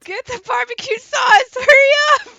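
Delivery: high frantic yelling; frantic shout. Pretend. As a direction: scream it in a panic. Frantic joke rescue yelling.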